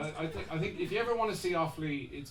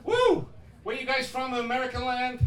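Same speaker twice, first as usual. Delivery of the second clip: high, loud mock American; hammed-up American accent; put-on American accent; 7 semitones higher, 5 dB louder than usual. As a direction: call out to fellow Americans in a fake accent. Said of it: "do you believe my accent".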